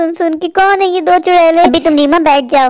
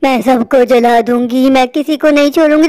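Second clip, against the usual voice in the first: witch voice; 6 semitones lower than usual. Acted. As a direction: gloat over burning them. Witch burning the village.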